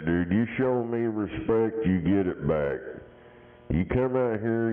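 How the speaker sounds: usual speaking voice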